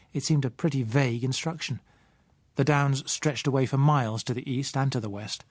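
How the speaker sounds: usual speaking voice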